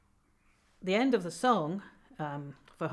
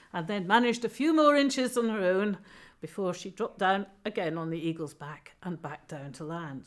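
ordinary delivery

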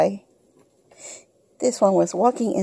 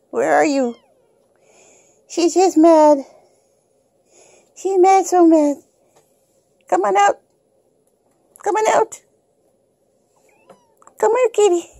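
high pet-talk voice; 9 semitones higher than usual. Real, not acted. Owner coaxing her kitten out of the burrito, high sweet baby talk. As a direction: coax the kitten in a high sing-song.

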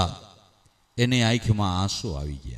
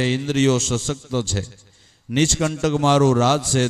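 ordinary talk, nothing marked

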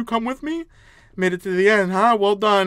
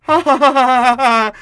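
loud, higher staged cackle; mocking laugh; 6 semitones higher, 9 dB louder than usual. Game character mocking a wrong answer, mocking laugh. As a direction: laugh out loud in separate ha-has.

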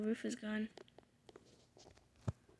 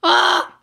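startled scream; 8 semitones higher, 20 dB louder than usual. Real, not acted. Sudden scare in game.

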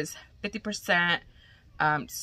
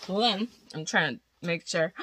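usual speaking voice

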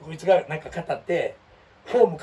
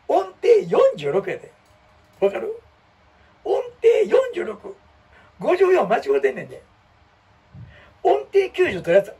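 much higher and louder, slow stunned outburst; real incredulous shouting; disbelief; 13 semitones higher, 6 dB louder, slower than usual. Real, not acted. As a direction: exclaim at the absurd score in disbelief. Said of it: "俺、理解できへんねん".

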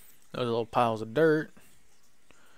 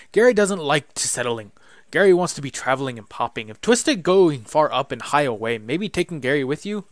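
louder, eager little goblin greeting; excited goblin; 9 dB louder than usual. Acted. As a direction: bounce through the hello like a giddy goblin. Goblin Gary voiced.

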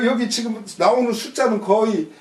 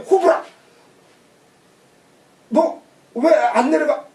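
mock scolding, pitched-up opening; 4 semitones higher than usual. King orders Mosu to get down, mock scolding. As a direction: call out a sharp mock rebuke. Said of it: "꾸짖는 거 왜 꾸짖었어?".

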